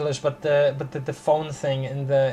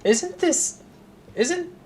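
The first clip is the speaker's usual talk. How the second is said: astonished; 4 dB louder, slower than usual. Real, not acted. Recognising the voice on the phone.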